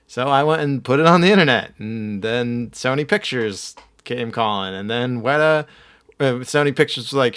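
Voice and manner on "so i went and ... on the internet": usual speaking voice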